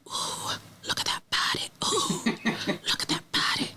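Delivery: breathy mock whisper imitating the jungle voices. A viewer mimics the island's whispers in a mock whisper. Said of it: "Not these damn whispers again".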